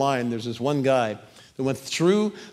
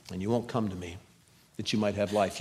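sorrowful rebuke; 5 semitones lower, 7 dB quieter, faster than usual. Acted.

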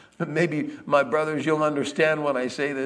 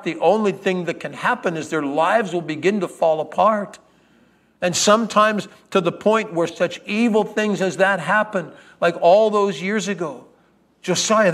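louder, higher passionate scolding; fiery rebuke; 4 semitones higher, 7 dB louder than usual. Acted. Sarah scolding Josiah with fire, loud and passionate. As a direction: preach it at him with fire. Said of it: "with fire in her eyes".

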